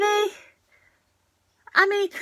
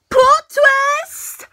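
high, loud, drawn-out announcement; dramatic exclamation; 10 semitones higher, 7 dB louder than usual. Acted. Vlogger reacting to the episode's twist, dramatic exclamation. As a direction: belt it out as a big mock reveal.

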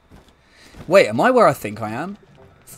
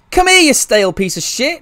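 high, loud imitated telling-off; mock angry yell; 10 semitones higher, 7 dB louder than usual. Imagined bully insulting the stale bread, mock angry yell. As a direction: yell it like a scolding. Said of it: "You're like".

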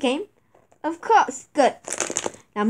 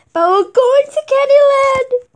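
excited high cheer; 8 semitones higher, 5 dB louder than usual. Acted. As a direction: burst out with glee. Excited announcement, far voice.

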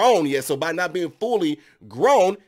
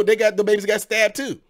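ordinary talk

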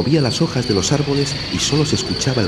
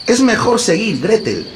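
put-on boy voice=76; 5 dB louder than usual. A narrator's reading. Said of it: "dijo su hermano".

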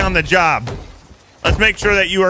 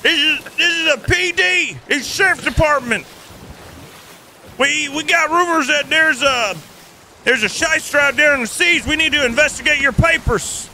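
raised, official lawman voice stopping a ship; mock sheriff; 6 semitones higher than usual. Sheriff hails a pirate ship: hammed-up lawman voice. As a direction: play the small-town sheriff hailing a boat.

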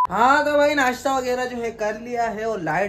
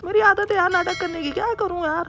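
high falsetto wife voice, a bit louder; mimicking wife; 11 semitones higher, 3 dB louder than usual. Husband mimics his wife to the camera, high falsetto. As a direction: squeak it out like a pouty wife. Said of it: "mimicry कर रहा हूँ मैं".

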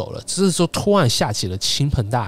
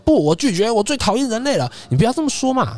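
put-on old bean-washing spirit flatly refusing the rain boy; indignant refusal; 13 semitones higher than usual. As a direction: snap it out like a cranky old spirit. Old yokai refuses, hates humans.

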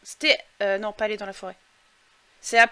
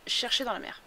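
usual speaking voice

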